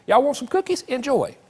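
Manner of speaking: put-on high, sweet old granny offering cookies; grandmother voice. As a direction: play a doting grandma handing out cookies. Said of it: "That's how all grandmothers talk".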